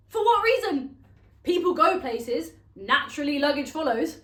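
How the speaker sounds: loud, high rhetorical outburst; indignant protest. Acted. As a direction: protest it loudly, starting high. Indignant rant about luggage.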